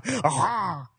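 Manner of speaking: "Rawr" as a breathy, French "hah"; "Rawr" said as a French-accented "hrah"; French-style roar. Shown as a demonstration. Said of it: "They would say".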